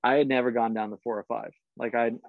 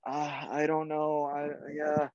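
slow, halting mock excuse; retold sheepish stammer; 3 semitones higher, 4 dB quieter, slower than usual. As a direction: fumble for an answer like a scolded kid. Retold sheepish answer to scolding.

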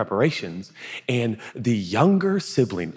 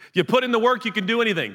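high-pitched pep-talk voice; hammed-up coach motivation; football coach; 11 semitones higher, faster than usual. Jesus as a coach urging hard work, mock pep talk. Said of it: "like the science teacher or the football coach".

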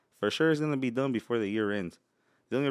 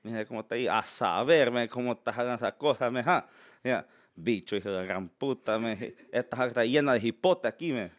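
Salvadorian Spanish street talk; cussing Salvadorian accent. Shown as a demonstration. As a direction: rattle it off in Salvadorian slang. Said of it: "little bit of Salvadorian".

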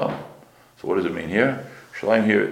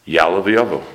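usual speaking voice

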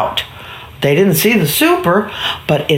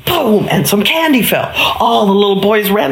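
ordinary delivery, nothing marked

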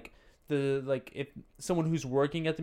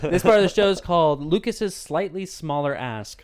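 show announcer voice; 5 semitones higher, 10 dB louder than usual. Acted.

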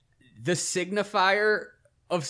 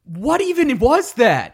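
disbelief; 8 semitones higher, 9 dB louder than usual. Real, not acted. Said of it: "disbelief at sketch".

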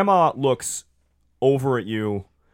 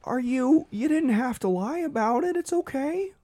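very high, gently chiding; hurt husband; 13 semitones higher than usual. Acted. Gary telling Dolores she didn't have to lie, very high.

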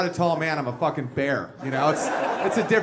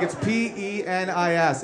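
slow spelling out; slower than usual. Retold or imitated. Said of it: "I'm like".

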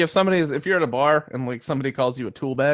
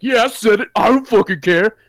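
loud, higher mock belligerence; raised-voice cocky comeback; defiant retort; 9 semitones higher, 9 dB louder than usual. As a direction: imitate a stranger loudly owning up. Drunk racist stranger's defiant retort retold.

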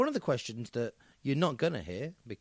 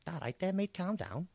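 put-on higher, light Scouse accent; 5 semitones higher, 4 dB quieter than usual. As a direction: stock comic Liverpudlian. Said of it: "yeah, Scouse".

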